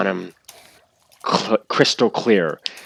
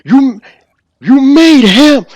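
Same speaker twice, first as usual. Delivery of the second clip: furious shout; 13 dB louder than usual. Acted. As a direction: roar the accusation. Shouted accusation repeated.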